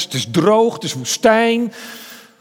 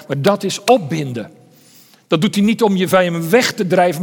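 usual speaking voice

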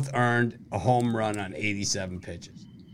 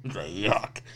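normal talking voice

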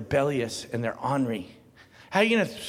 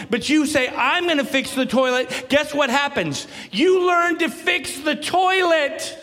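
full-force preaching; 10 semitones higher, 6 dB louder than usual. Acted. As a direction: drive it home to the whole congregation.

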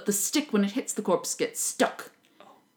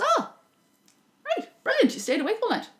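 much higher, gushing praise; delighted woman; 11 semitones higher than usual. Retold or imitated. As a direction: gush over it like a thrilled hostess. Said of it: "the woman of the house comes down and goes".

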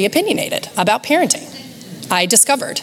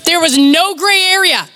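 ordinary delivery

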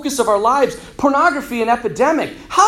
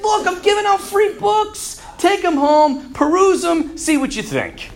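high-pitched, pleading sales pitch; eager persuasion; 8 semitones higher than usual. Acted. Alexander pushes free books on reluctant people, eagerly persuasive. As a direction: urge them on in a raised voice.

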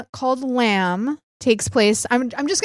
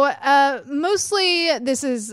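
usual speaking voice

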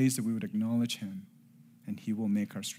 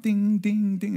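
high, loud "ding, ding"; imitated phone alerts; 10 semitones higher, 8 dB louder, slower than usual. Dad mimicking daughter's phone going off, insistent high dings. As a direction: mimic a phone pinging away. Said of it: "her phone's going off".

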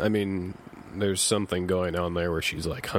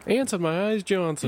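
TV commercial character; 11 semitones higher than usual. Retold or imitated. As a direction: yell it like a cheap commercial. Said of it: "I'm pretty sure".

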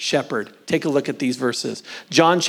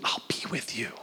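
much softer whispered promise; 5 semitones lower, 14 dB quieter than usual. Acted. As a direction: whisper it close and tender. God's promise, then repeated.

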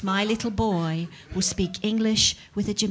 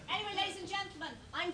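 high quick aside; 10 semitones higher, 14 dB quieter, faster than usual. Acted. Sudden fast high switch.

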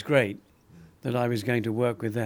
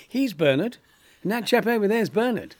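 mock excited build-up; 10 semitones higher, 5 dB louder than usual. Civil servant insists all are Bernard.

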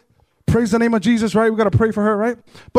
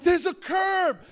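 raised-pitch mock yell; exasperated re-enacted shout; retold scream; 6 semitones higher, much slower than usual. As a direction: replay the yelled warning, half strength. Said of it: "I'm screaming at the top of my lungs".

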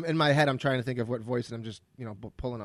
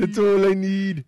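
put-on Scottish accent; 4 semitones higher, 8 dB louder, slower than usual. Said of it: "terrible Scottish accent".

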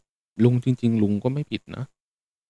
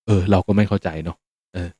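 usual speaking voice

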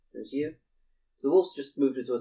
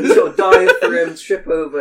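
laughing; 6 semitones higher, 14 dB louder than usual. Real, not acted. GM laughing through the narration.

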